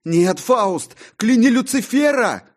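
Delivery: high, loud yell. A narrator's reading. John yells at Faustus across the theatre. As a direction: shout it at the stage. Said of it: "кричал я".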